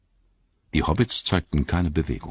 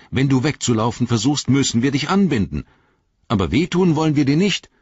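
lightly voiced Frodo, raised pitch; a higher, firm but well-meaning hobbit; Frodo, firm but kind; 8 semitones higher, 5 dB louder, faster than usual. A narrator's reading. Frodo to captive Gollum after tying him up, firm.

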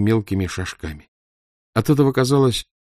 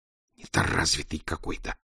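softer hissing insult; hissed; 4 semitones lower, 10 dB quieter than usual. A narrator's reading. Aglaya hissing an insult at the narrator. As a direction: hiss it with contempt. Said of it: "прошипела Аглая".

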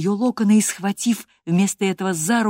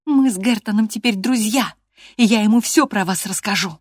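high, loud stubborn challenge; defiant threat; 5 semitones higher, 6 dB louder than usual. A narrator's reading. Girl threatens to tell Gerton everything, defiantly. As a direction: challenge him in a raised, high voice. Girl goes on defying the master.

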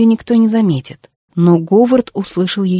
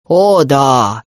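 put-on lower, soothing old sage voice; reassuring sage; 6 semitones lower than usual. A narrator's reading. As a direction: reassure him like a wise elder. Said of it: "заверил его мудрец".

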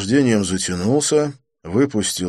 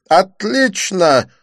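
delighted; 12 semitones higher, 9 dB louder, much slower than usual. A narrator's reading. Didier delighted.

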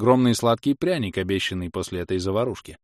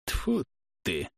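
higher, soft spat-out curse; vexed spitting; 4 semitones higher, 5 dB quieter than usual. A narrator's reading. Witch thwarted in her curse, vexed spit. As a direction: spit it out in vexation. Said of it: "Ведьма досадливо плюнула".

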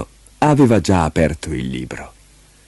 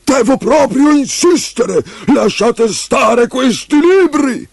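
slow, much higher, louder demand; insistent minister; 3 dB louder, slower than usual. Acted. Prime minister orders Leo to leave the books, loud high voice. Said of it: "disse il primo ministro".

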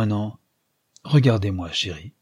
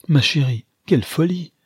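rising protest, a little louder; exclaiming; 6 semitones higher, 3 dB louder than usual. A narrator's reading. Archer protesting to May, exclaiming. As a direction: cry it out, brushing it off. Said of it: "s'écria-t-il".

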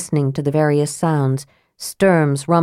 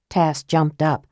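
ordinary delivery, nothing marked

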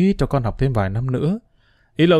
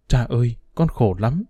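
tearful plea; 2 semitones lower than usual. A narrator's reading. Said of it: "Tôi quỳ xuống bên chân ông mà khóc".

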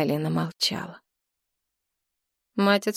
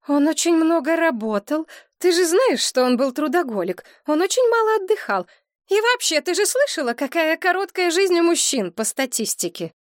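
much higher, insistent explaining; anxious mother; 10 semitones higher, 3 dB louder than usual. A narrator's reading. Mother insisting her husband's death was natural, anxious. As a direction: talk herself into it.

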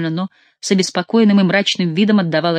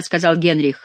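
plain reading voice

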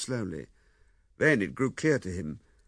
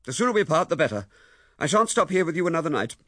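cold angry resolve; 6 semitones higher, 7 dB louder than usual. A narrator's reading. Wife answers in anger.

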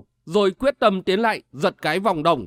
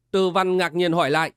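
plain reading voice

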